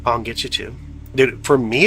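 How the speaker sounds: usual speaking voice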